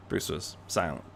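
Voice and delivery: ordinary delivery, nothing marked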